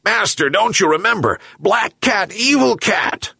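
high-pitched angry dog voice; angry yelp. A narrator's reading. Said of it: "yelped the dog even more angrily".

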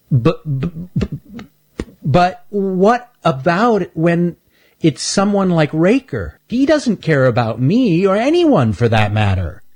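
high, halting stuttering voice; a nervous young critter stumbling over words; Stanley's stutter. Acted. Stanley worries to Shelby about Raker, stammering high.

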